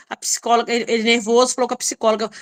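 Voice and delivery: usual speaking voice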